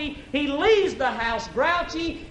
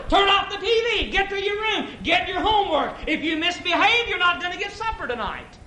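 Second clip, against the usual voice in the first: mock screaming mother; 8 semitones higher than usual. Mother screaming at her ten-year-old boy, mock shouting. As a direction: yell at the kid like a frazzled mom. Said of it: "starts screaming and hollering".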